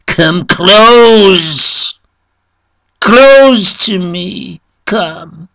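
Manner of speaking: high, loud, drawn-out plea; wounded man's desperate high call; painful cry. A narrator's reading. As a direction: cry out in pain for help. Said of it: "uttered a painful cry".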